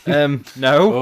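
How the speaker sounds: lightly voiced puzzled answer; acted joke reply. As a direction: imitate the detectorist's hesitant reply.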